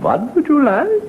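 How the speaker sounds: high, put-on innkeeper voice; a high sing-song barman greeting. Innkeeper greeting the traveller in a high voice.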